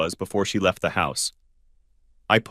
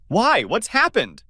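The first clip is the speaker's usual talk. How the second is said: much higher, alarmed cry; alarmed shriek; 12 semitones higher, slower than usual. Acted. Ken panicking on the phone to Anne, high shriek. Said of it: "shrieked I in alarm".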